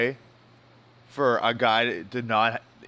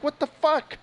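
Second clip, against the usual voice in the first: much higher, baffled what the fuck; exasperated; 13 semitones higher, slower than usual. Acted. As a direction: throw up your hands in disbelief. Exasperated at no-win situation.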